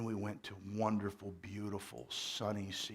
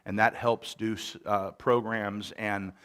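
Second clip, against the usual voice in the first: full-force church announcement; preaching voice; 3 semitones higher, 12 dB louder than usual. Acted. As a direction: project it from the pulpit. Preaching into microphone.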